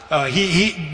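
usual speaking voice

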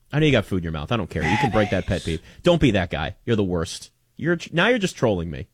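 normal talking voice

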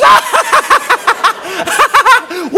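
high, loud imitated cackling fit; hysterical mocking laughter. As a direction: imitate a girlfriend who can't stop laughing. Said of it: "And she goes".

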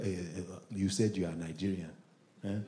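put-on low, quiet Nigerian accent. Nigerians testing a friend, quiet Nigerian accent. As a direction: question him softly in a Nigerian accent.

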